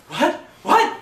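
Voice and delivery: loud, high-pitched shouted question; outraged disbelief. Retold or imitated. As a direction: shout it back in shocked outrage. Acted startled, outraged actor on phone.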